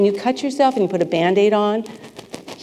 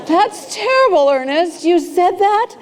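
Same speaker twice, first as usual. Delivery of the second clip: mock outrage; 10 semitones higher, 4 dB louder than usual. Mock outrage at teacher's quote.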